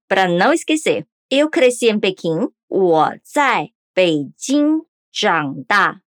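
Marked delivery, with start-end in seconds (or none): 2.7-6.0 deliberate, syllable by syllable; 我在北京长大 said slowly as wǒ zài Běijīng zhǎng dà; slow Mandarin demo. Shown as a demonstration. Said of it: "Vamos repetir de novo".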